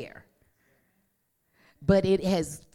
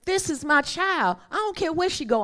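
high, fervent voice of God; 11 semitones higher, faster than usual. Acted. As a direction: raise the pitch, fervent and sure. Voicing God about his child.

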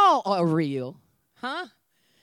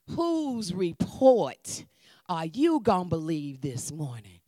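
normal talking voice

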